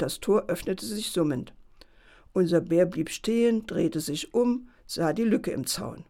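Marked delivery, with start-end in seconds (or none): none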